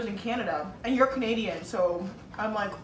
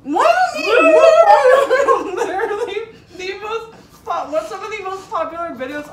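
high, loud laughing protest; laughing disbelief; 9 semitones higher, 11 dB louder than usual. Real, not acted. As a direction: laugh it out, incredulous. Excited disbelief at friend.

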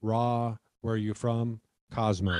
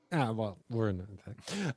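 soft, offhand mock acceptance; quick resigned turnaround; 8 dB quieter than usual. Imitating a friend's quick turnaround, softly resigned. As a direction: shrug it off under your breath, imitating. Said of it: "and then it was an immediate".

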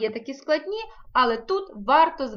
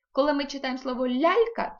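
ordinary talk, nothing marked

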